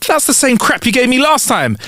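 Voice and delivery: high, indignant protest; exclaiming. Acted. Lawyer accusing the doctor of repeating himself, high exclamation. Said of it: "the lawyer exclaims".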